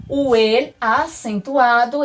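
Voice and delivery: ordinary talk, nothing marked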